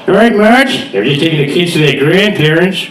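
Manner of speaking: hammed-up cartoon dad greeting his wife; Homer Simpson. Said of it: "Homer comes back to the house".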